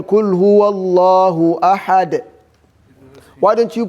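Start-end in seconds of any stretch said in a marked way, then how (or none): 0.0-2.2 quick chanted Quran recitation; teacher reciting a verse to show the stop. Shown as a demonstration. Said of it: "why are you stopping on".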